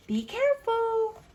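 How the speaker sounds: a lightly voiced mom calling after kids; mom's warning. Parent voiced warning children.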